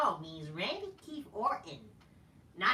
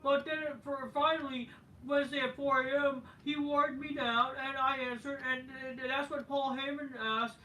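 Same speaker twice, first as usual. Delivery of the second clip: high, louder put-on Kevin Owens voice; imitating Kevin Owens; 8 semitones higher, 3 dB louder than usual. Kevin Owens rambling to the crowd in a high, put-on voice. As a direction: perform him as a rambling host. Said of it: "Kevin Owens says".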